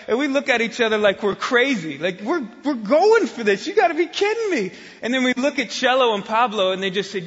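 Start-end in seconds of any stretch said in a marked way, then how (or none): 2.2-4.7 higher, faster you gotta be kidding me; incredulous. Retold or imitated. Two pastors staring at each other on the cliff road, incredulous. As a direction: voice climbing in disbelief.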